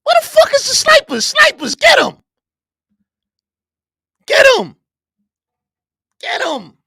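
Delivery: high, loud playful yell for the snipers; rally the troops, shouting at the top of the voice; hammed-up shout calling in snipers; mock battle yell. Mock call for snipers, yelling.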